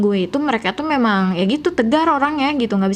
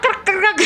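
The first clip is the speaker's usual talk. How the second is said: crunch sounds; 10 semitones higher than usual. Retold or imitated. Imitating crunching vegetables.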